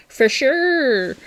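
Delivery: put-on light Canadian accent on "For sure"; a playful hint of a Canadian accent; a hammed-up Canadian "for sure". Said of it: "that sounds very Canadian there".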